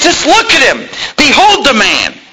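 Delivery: high, straining plea as Pilate; Pilate pleading. Retold or imitated. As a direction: show the crowd the beaten man, begging them to see. Pilate presenting Jesus again.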